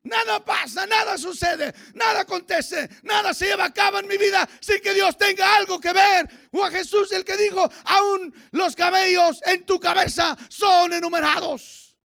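loud, high shouted sermon; full-force preaching. Real, not acted. Preacher to congregation on God's control, full-force preaching. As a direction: drive every phrase home at a shout.

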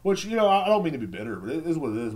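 ordinary talk, nothing marked

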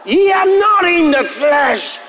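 very high, loud shouted proclamation; full-force preaching. Real, not acted. Preacher proclaims to congregation they are in the Spirit, shouted. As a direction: shout the verse in triumph, stretching key words.

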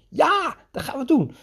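put-on high, thrilled kid agreeing; imitating the boy. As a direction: play the excited little boy. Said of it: "Toen zei hij".

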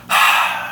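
big breathy sigh of relief; acted gasp of regained breath; relieved breath. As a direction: let out one voiceless gust of air. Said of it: "and he felt breath".

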